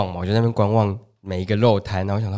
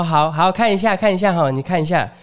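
market auntie; 6 semitones higher than usual. Retold or imitated. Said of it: "然后说".

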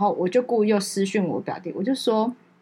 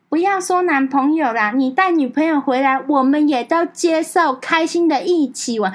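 higher, slow, bright-hearted auntie; a higher, slowed auntie announcing it; aunt's cheerful voice; 6 semitones higher, 4 dB louder, slower than usual. Retold or imitated. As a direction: voice the aunt welcoming everyone, slowly. Aunt voiced in group chat.

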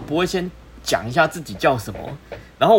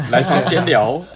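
笑着说; 3 semitones higher, 4 dB louder than usual. Real, not acted. 笑着复述.

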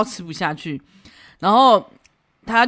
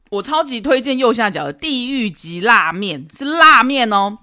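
slow, louder push on 辣面; emphatic recommendation; 4 semitones higher, 6 dB louder, slower than usual. Retold or imitated. Regular diner hyping the spicy noodles to a friend. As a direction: lean on 是辣面哦 slowly. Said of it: "跟另外一个人说".

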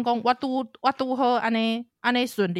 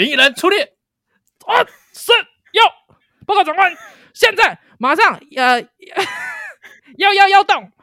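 soldier reporting; 11 semitones higher, 12 dB louder than usual. Retold or imitated.

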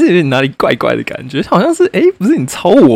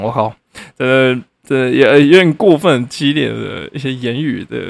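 ordinary talk

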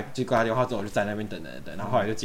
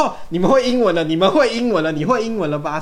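high, louder mock rant; angry yelling; 8 semitones higher, 8 dB louder than usual. Rude guy shouting at them for speaking English. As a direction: hammer the accusation.

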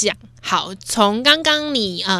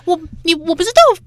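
higher, louder, fast parent scold; mock scolding parent; 6 semitones higher, 3 dB louder, faster than usual. Host imitating a parent scolding a failing child, fast. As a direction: rattle it off like a nagging parent.

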